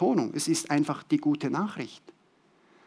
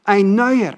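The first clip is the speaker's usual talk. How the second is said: neuer repeated louder and higher; stress on NEUER; 5 semitones higher, 8 dB louder than usual. Shown as a demonstration. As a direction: drive home neuer. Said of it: "ein neuer Apfelbaum".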